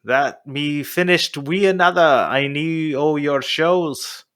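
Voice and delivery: hammed-up northeast Scottish reading; slow, stumbling fake Scottish brogue read aloud; horrible Scottish accent. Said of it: "that was my horrible Scottish accent".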